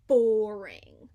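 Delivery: Boring said loud and falling; loud groaned boring. A narrator's reading. Narrator groaning loudly at how boring the pond is. Stressed boredom.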